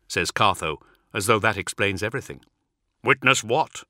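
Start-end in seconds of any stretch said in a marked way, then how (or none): 3.0-3.8 mildly forceful, impatient demand; lightly acted impatient warrior demanding answers. A narrator's reading. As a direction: press for an answer, a touch sharp. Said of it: "demands Hol Beloth".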